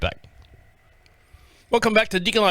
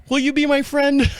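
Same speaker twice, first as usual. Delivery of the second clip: higher-pitched, playful begging; mock pleading; 7 semitones higher than usual. Lonely man asking new neighbor for friendship, mock pleading. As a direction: plead jokingly in a raised voice. Repeats the plea higher, then laughs.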